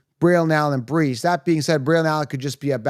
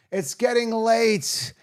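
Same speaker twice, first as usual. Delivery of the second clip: slow, higher, drawn out like a sigh; weary drawn-out; 7 semitones higher, slower than usual. Real, not acted. Late-night host telling viewers he's worn out, weary drawl. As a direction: let it stretch out tiredly. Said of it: "Oh my God".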